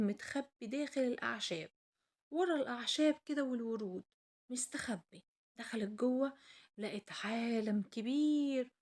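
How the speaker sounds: plain reading voice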